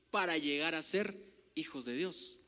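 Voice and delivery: normal talking voice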